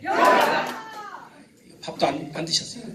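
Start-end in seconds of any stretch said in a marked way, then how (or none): 0.1-0.8 high, loud yell of yah; loud shout. Shown as a demonstration. Pastor shows the congregation's shout, big yell. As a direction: belt it out. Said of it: "준비하면 야! 하는 거예요".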